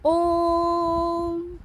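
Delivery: "ओम" held very long on one steady loud tone; teacher stretching the word ओम; prolonged om. Shown as a demonstration. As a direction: chant the ओम out slowly like a pluta vowel. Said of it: "शब्द है".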